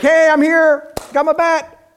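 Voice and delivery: high, loud cocky voice; naive rookie. Pretend. Preacher mocking hopeful ballplayer, high and loud. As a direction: play the clueless hopeful. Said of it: "you go down to Boston and be like".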